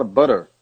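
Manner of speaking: butter with the T turned into a tapped R; American flapped T. Shown as a demonstration. Teacher imitating an American, "butter" with a flapped T. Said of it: "O T vira R".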